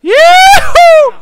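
high, loud whoop of joy. Real, not acted. Streamer whooping at the fight in game. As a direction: let out a loud yeehaw.

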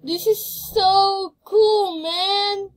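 high, loud and slow with delight; thrilled. Real, not acted. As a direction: savour how cool it is, loudly. Thrilled by the cool feature.

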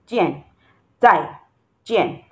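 "Jian" and "Tai" each dropping in pitch; falling tones. Shown as a demonstration. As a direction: let "Tai" and "Jian" fall, one by one. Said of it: "That is the falling tone for you".

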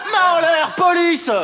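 loud, high cop order; police shout. Acted. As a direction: bark the arrest order. Sketch, arrivée de la police. (police qui crie)

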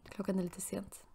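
soft whisper aside. Real, not acted. Player whispering to viewers about the late hour. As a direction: whisper it so nobody wakes.

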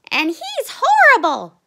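high, loud cub outcry; crying out. A narrator's reading. Ronnie tells mother about the bully, crying out. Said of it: "cried Ronnie".

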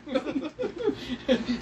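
high-pitched laughing burst. Real, not acted. Laughs at the bit.

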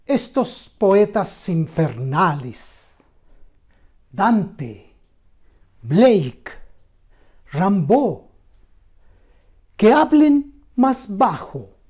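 loud, slow declamation with long pauses between phrases; impassioned poem recital. Acted. Reciting a poem on Auschwitz to channel viewers, impassioned declamation.

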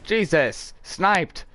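high-pitched startled outburst; startled exclamation. Real, not acted. Player reacts to a sniped hit, startled. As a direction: exclaim it sharply, pitch jumping up.

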